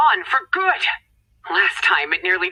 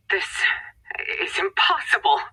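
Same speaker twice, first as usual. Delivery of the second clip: high, breaking whisper of disbelief; shocked whispered cry; 9 semitones higher, 4 dB quieter than usual. Acted. Handler losing the signal, whispering in disbelief. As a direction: gasp it out in dismay.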